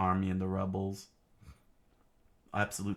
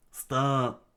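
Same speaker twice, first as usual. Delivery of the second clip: stop call; 6 semitones higher, 6 dB louder than usual. Acted. Someone calls halt.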